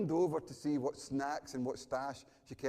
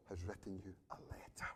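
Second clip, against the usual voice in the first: near-whisper, very soft and low; hushed; 6 semitones lower, 14 dB quieter than usual. Real, not acted. Preacher telling listeners God wrote to them, hushed. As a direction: drop almost to a whisper for the reveal.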